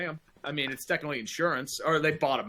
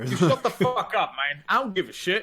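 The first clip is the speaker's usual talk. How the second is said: higher, laughing fake outrage; laughing mock anger; 4 semitones higher than usual. Cohost mock-snapping at his partner while laughing. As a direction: snap it through a laugh, joking.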